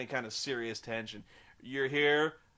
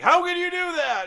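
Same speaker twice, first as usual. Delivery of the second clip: high, loud hammed-up indignation; mock angry outburst; 14 semitones higher, 10 dB louder than usual. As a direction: play Bella furious and shrill. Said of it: "she's mad".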